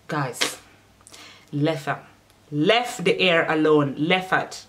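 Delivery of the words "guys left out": ordinary talk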